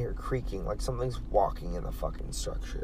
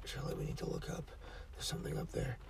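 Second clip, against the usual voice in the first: very soft retold scared whisper; frightened whisper; 15 dB quieter, faster than usual. Urging coworker to look up at the ghost, whispering. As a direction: barely breathe the words out.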